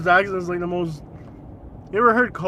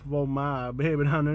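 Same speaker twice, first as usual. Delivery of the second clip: Boomhauer; 8 semitones lower, 4 dB quieter than usual. Retold or imitated. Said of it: "Sound like Boomhauer had a baby".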